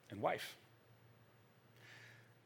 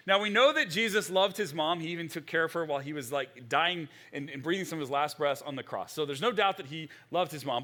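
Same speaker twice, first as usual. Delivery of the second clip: normal talking voice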